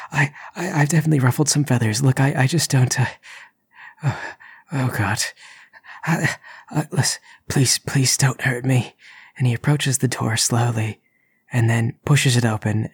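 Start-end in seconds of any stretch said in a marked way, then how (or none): 0.1-8.9 high, stammering plea, whispery; terrified begging. Acted. Intruder begging the unseen spirit not to hurt him. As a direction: beg for mercy, breaking.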